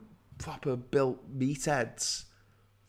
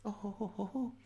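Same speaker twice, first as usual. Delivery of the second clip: high, soft giddy coo; mock swooning; 9 semitones higher, 8 dB quieter than usual. [name] goes weak at the knees, mock swooning.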